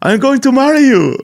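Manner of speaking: high, put-on suitor voice; hammed-up high-pitched marriage promise. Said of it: "And he's like".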